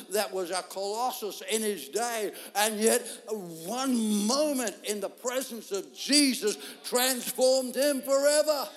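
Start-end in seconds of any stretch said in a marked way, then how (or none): 2.6-8.7 slow, loud climax. Acted. Sermon climax.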